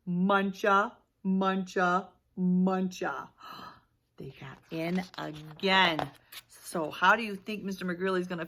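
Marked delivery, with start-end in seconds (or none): none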